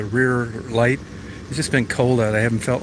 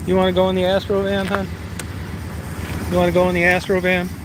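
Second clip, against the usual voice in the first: pet talk; 6 semitones higher, faster than usual. Real, not acted. Asking Daisy if she wants the van ride, high fast baby talk. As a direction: coax the dog in a high quick coo.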